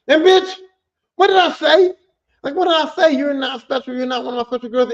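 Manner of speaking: high, loud outraged retort; indignant yell. Retold or imitated. Retelling the rude dancer, outraged high yell. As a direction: rant at the dancer who won't leave. Retelling strip club confrontation.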